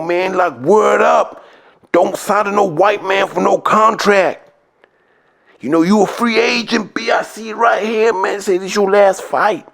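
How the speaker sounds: a hammed-up New York street hustler pitching a deal; street hustler impression. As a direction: play a fast-talking hanger-on working on a fighter. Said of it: "he's just clowning".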